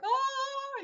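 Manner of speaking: a high, drawn-out mock moan of fear; imitated moan. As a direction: copy the husband's scared moan in his sleep. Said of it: "he moans this horrible moan".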